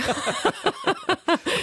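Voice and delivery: high bursting laugh; burst of laughter. Real, not acted. Reacts to accent question. (lautes Lachen)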